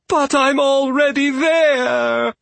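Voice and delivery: very high, loud drawn-out squeal; terrified wail. A narrator's reading. Rabbit answers the steward's threat, wailing. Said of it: "Argabella wailed".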